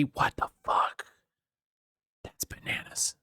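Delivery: awed whisper, barely voiced; stunned whisper. Real, not acted. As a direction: hush it like you can't believe it. Stunned by Valiant fighting Fairy.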